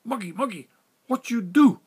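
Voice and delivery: put-on loud, high exclamation in broken Chinese-accented English; broken English exclaim. Captain confronting the narrator at the burn drum, loud broken-English exclaim. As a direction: blurt it out in alarm, broken English. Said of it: "In his broken English, he exclaimed".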